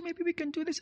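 soft, high coaxing voice; mock confidential suggestion; hushed suggestion. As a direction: quietly pitch an idea to the pastor. Acting member coaxing the pastor.

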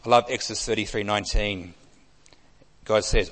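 ordinary talk

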